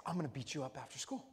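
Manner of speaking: low hushed bully threat, a bit quick; quiet mock threat. Bully whispering a threat to young Brian, hushed. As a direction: hush it like a kid threatening. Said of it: "he's like".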